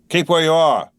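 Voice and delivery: high, loud call into the mist; guard calls to the rider. A narrator's reading. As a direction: shout a warning across the road. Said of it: "the guard called to the voice".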